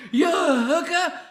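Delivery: loud, high joking yell; mock call-out. Comedian jokingly hailing someone, high loud mock yell. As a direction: holler it high and loud for laughs. Calling out in a joke.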